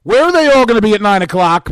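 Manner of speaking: loud, rousing host shout; booming hype question. Real, not acted. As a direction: belt it out to the listeners. Hyping the nine o'clock show.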